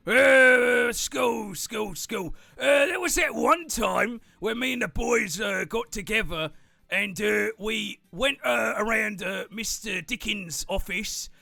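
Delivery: boastful merchant. Acted. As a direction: merchant brags about a prank. Merchant tells school story.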